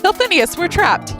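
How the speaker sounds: put-on high, loud frightened boy; frightened cry. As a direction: cry out trapped. Character in net.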